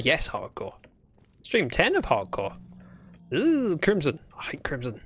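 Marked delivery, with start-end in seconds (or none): 4.4-5.0 unvoiced grumbling whisper. Real, not acted. Streamer whispering a grumble about the biome. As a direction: whisper your dislike to yourself.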